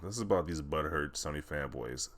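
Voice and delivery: ordinary talk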